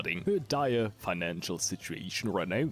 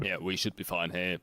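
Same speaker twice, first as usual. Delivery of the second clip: ordinary delivery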